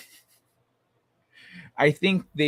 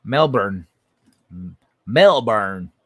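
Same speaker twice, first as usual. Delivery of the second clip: too American Australian try; 5 semitones lower, 5 dB louder, slower than usual. Shown as a demonstration. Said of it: "that's too American there".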